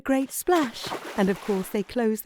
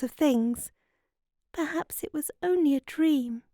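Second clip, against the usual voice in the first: put-on higher-pitched girl, musing on a half-memory; wondering girl; 6 semitones higher than usual. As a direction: voice a girl piecing together a dream. Narrator voices the girl.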